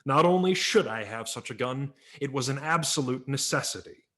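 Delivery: ordinary delivery, nothing marked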